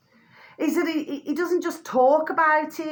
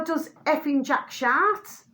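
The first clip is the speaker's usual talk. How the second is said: ordinary talk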